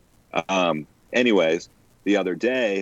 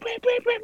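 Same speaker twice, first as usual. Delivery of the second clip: bird call. Retold or imitated. Imitating the bird's call.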